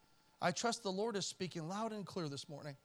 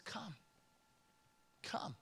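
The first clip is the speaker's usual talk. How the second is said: hushed, breathed invitation, much softer; soft whisper; 11 dB quieter than usual. Acted. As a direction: whisper it like a tender call. Quoting the Lord's invitation.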